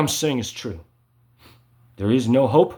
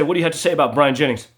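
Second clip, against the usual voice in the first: rapid interrogation; much faster than usual. Retold or imitated. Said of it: "And you say".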